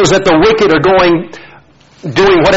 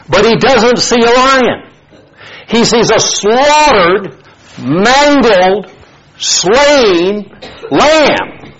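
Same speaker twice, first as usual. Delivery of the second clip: each word raised and weighted, building to lamb; dramatic reveal; 5 semitones higher, much slower than usual. Acted.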